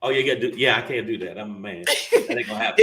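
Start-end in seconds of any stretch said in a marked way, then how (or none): 1.8-2.8 high, loud refusal. Pretend. Turning down the head move, high and loud.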